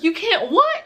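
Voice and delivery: high, startled echo question; shocked friend. Retold or imitated. Friend hearing she can't walk, shocked. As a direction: imitate a friend's squealed disbelief.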